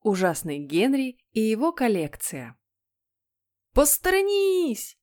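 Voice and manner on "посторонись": high, drawn-out yell; вопль. Acted. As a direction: yell it like a boy charging in, stretching it out. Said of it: "завопил ужасный Генри". (протяжный высокий вопль)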